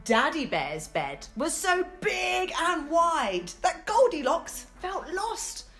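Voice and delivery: plain reading voice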